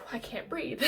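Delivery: sighed, voiceless whisper; breathless whisper. Real, not acted. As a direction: whisper it out after a sigh. Overwhelmed, sighing about stress.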